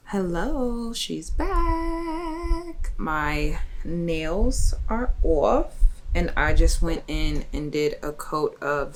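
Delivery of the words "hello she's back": cheerful return. Real, not acted. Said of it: "returning to camera greeting".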